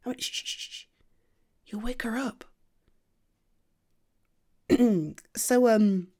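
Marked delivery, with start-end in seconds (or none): 0.0-2.4 soft shh then a whispered warning; whispered shushing, much softer. Acted. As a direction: hush them down gently. Hushing partner near sleeping cat.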